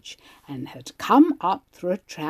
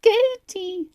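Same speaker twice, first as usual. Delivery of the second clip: high, loud pained cry; girl with a trapped toe cries out to her sister; 5 dB louder, slower than usual. Retold or imitated. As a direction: call to her sister, hurting. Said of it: "I cried".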